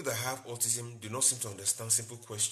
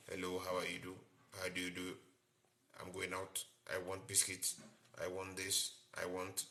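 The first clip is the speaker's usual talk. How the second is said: mock robotic, one flat tone, lower and softer; 4 semitones lower, 8 dB quieter than usual. Shown as a demonstration. Teacher demoing a monotone speaking child for the class. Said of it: "they're not speaking with the way I'm speaking".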